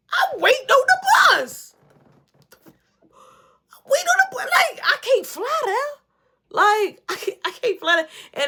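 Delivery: high, loud, cracking exasperated pleading; frantic exasperation. Retold or imitated. Telling Roosevelt on the phone, high frantic exasperation. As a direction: squeal it like you can't believe it.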